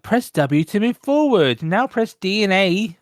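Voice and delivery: a hint of a condescending game prompt; mock tutorial. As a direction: drone it like a hand-holding tutorial. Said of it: "the amount of games that are like".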